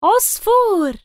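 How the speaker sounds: high, bright exclaimed reveal; excited answer. Acted. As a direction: announce it with delight to a child. Naming the animal.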